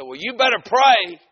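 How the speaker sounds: loud, high threat; shouted warning. Retold or imitated. Man warning the visiting preacher, shouted. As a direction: holler it as a threat.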